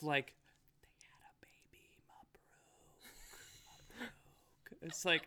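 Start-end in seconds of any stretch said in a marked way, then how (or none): 1.0-4.1 barely voiced mouthed whisper; parent's whispered coaching. Parent coaching child on the phone, mouthed whisper. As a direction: whisper the prompt with almost no sound. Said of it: "my dad or mum will whisper something".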